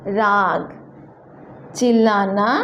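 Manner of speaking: ordinary talk, nothing marked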